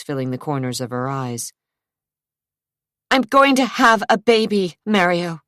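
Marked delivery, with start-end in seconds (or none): 3.1-5.4 higher, louder, insisting; angry. A narrator's reading. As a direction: fling it at him in anger. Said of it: "she said angrily".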